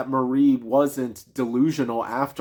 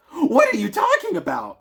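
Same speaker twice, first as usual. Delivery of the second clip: much higher and louder, a shocked mother's cry; horrified mother; 3 dB louder than usual. Acted. The mother, horrified at her daughter's words, high shocked cry. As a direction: let the horror burst out. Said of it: "she said".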